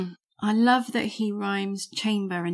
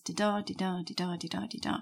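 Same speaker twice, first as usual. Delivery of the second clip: five "di-da" beats, soft "di" and stressed "da", fading softer; iambic beat; 6 dB quieter, faster than usual. Shown as a demonstration. Teacher counts out the five iambs, stressed beats. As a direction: chant "di-da" five times like a steady pulse. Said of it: "five iambs".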